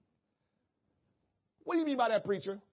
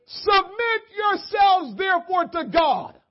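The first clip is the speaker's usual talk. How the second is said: higher and louder, slow and stretched; shouted scripture; 8 semitones higher, 6 dB louder, slower than usual. Real, not acted. Preacher proclaiming the verse to the congregation, loud. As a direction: call the verse out to the church.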